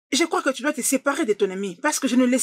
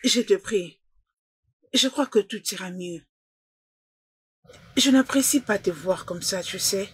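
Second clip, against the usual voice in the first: tender, lowered voice with long pauses; gentle consoling; 2 semitones lower than usual. Acted. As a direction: comfort someone who is crying. Consoling a crying friend.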